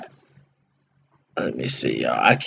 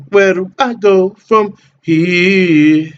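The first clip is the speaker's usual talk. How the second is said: slow sing-song, pitched higher and louder; drawn-out repeat; 5 semitones higher, 4 dB louder, much slower than usual. Real, not acted. Streamer lost in the menu, drawn-out slow and higher. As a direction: stretch every word out like a lazy tune.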